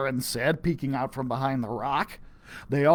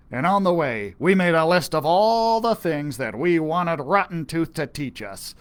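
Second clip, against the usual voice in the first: ordinary delivery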